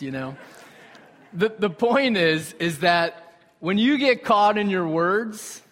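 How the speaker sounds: ordinary talk, nothing marked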